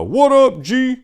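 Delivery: high, loud buddy-buddy hail; bro greeting. Retold or imitated.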